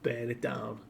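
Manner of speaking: understated weeping order; mildly tearful. Retold or imitated. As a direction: quietly give up, holding back tears. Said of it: "[name] is in floods of tears".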